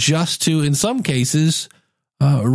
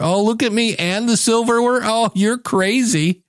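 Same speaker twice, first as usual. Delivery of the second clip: higher, hammed-up self-congratulation, slowing at the end; playful, high mock boast; mock pride; 7 semitones higher than usual. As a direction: tease yourself for being proud. Mock self-praise over silverware.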